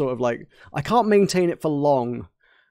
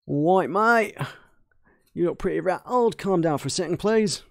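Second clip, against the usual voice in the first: put-on Cockney, pitched higher; Cockney accent; 4 semitones higher than usual. Shown as a demonstration. Mechanic calming a rattled visitor, Cockney accent. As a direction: lift each phrase in broad Cockney. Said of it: "my Australian turns into kind of Cockney".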